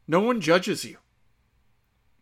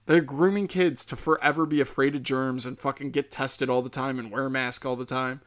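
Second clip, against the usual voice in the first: usual speaking voice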